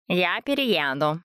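slow, sulky threat; threatening. A narrator's reading. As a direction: drag out a parting threat. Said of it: "пригрозила Европа напоследок".

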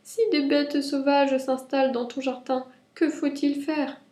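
high rabbit voice asking for advice; high, childlike plea for help; gentle little voice. A narrator's reading. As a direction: ask mother in a small, high voice. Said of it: "dit doucement Lapin".

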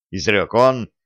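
plain reading voice

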